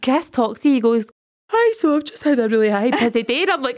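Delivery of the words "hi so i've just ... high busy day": imitating someone talking through a put-on yawn; fake yawn. Co-host mimics Chris claiming a busy day, fake yawning. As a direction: stretch the words out into a mock yawn. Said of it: "That's a pure fake yawn".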